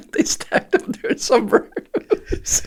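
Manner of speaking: wheezing with laughter through the words; high laughing squeal. Real, not acted. Punchline cracking him up.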